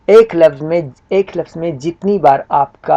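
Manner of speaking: ordinary delivery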